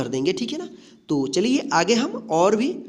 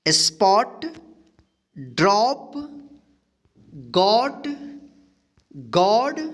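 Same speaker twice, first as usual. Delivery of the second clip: direct word reading. Shown as a demonstration. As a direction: read a word list for learners, stretching each word with a lifted end. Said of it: "direct पढ़ना सीखते हैं".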